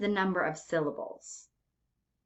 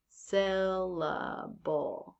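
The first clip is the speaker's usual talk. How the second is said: SYLLABLE very slow and softer, in three drawn-out beats; 6 dB quieter, much slower than usual. Shown as a demonstration. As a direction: stretch "syllable" right out, softly, beat by beat. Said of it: "Every time that your chin comes down".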